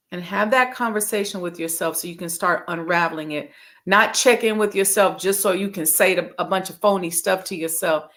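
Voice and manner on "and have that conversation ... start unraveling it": ordinary talk, nothing marked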